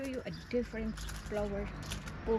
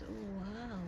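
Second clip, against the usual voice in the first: ordinary talk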